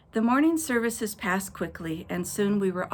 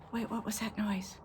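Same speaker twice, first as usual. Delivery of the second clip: hushed suspense; 9 dB quieter than usual. Pretend. Narrator pretends to hear noise.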